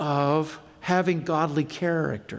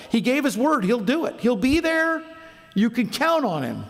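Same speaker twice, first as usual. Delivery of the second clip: raised, higher preaching voice building in force; 6 semitones higher than usual. Acted. Pastor urging young people to be reliable, raised preaching voice. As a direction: drive it home from the pulpit.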